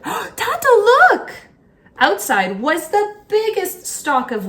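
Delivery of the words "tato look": high, loud cry of discovery; excited shout. A narrator's reading. Marusia calling her father to see the wheat, high and loud. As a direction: call out to her father in delight. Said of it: "Marusia shouted".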